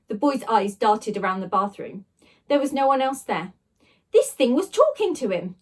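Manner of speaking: ordinary delivery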